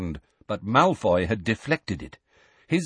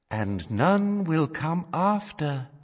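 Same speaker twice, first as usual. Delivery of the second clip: put-on ghostly young woman; 7 semitones higher, slower than usual. A narrator's reading. Young woman figure prophesying, slow high eerie. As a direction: murmur it like a ghost. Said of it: "said the figure of a young woman".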